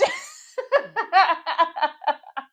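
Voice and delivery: loud, high burst of laughter; cracking up. Real, not acted. Vlogger laughing at Trinity's comeback, cracking up. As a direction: burst out in a high, rolling cackle.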